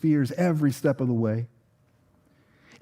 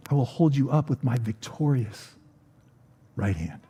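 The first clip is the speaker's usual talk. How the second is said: gentle reassurance. Retold or imitated. Quoting God's reassuring words.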